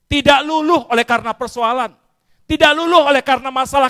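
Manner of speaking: high, loud proclaiming cry; preaching shout. Acted. Preacher booming to the congregation about unbroken faith. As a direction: hammer it home to the congregation.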